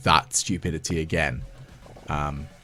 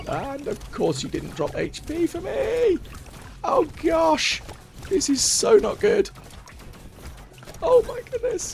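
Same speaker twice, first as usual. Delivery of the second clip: much higher-pitched, panicked complaint; real panic. Real, not acted. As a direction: exclaim in alarm, voice shooting right up. Gamer nearly dies, no health drops.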